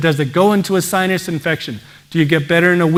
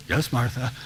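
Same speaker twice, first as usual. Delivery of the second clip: lower, much softer, submissive reply; meek husband; 5 semitones lower, 8 dB quieter than usual. Retold or imitated. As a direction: give in quietly to the bossy wife. Henpecked husband gives in to wife.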